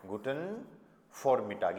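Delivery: "vormittag" said as "formittag", with V like F. Shown as a demonstration. Teacher showing students that German V sounds like F. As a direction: give "vormittag" a clear F sound at the start. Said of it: "वी को फे sound करते हैं".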